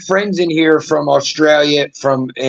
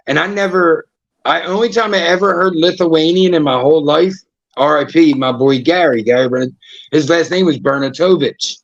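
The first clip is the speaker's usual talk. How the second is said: ordinary talk, nothing marked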